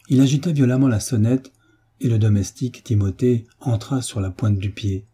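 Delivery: plain reading voice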